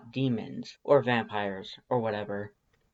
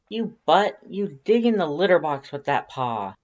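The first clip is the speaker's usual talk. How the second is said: louder, higher playful telling-off; mock scolding; 5 semitones higher, 6 dB louder than usual. Scolding the cat for its litter-box paw, mock outrage. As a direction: scold the cat like a naughty child.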